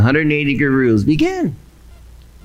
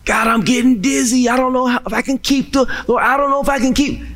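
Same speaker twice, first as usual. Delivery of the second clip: higher, desperate plea; faltering, dizzy begging; crying out; 8 semitones higher, much faster than usual. Acted. Believer begs God, feeling faint, pleading. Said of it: "crying out to God".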